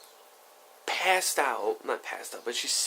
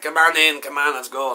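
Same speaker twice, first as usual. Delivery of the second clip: loud, higher-pitched acted beckoning; loud, eager urging; 5 semitones higher, 12 dB louder than usual. Friend urging the teller into a house, loud and eager. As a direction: play a friend loudly hurrying someone in.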